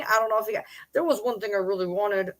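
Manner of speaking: normal talking voice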